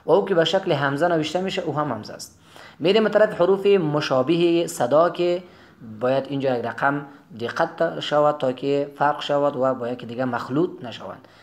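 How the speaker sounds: ordinary talk, nothing marked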